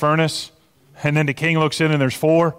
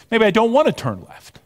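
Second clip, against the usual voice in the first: higher-pitched mild protest; reluctant thought; 8 semitones higher than usual. Acted. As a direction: voice a reluctant inner objection. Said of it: "but then you're thinking".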